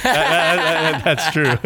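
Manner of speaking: laughing through the words. Real, not acted. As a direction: chuckle while agreeing. Laughing agreement about his wife.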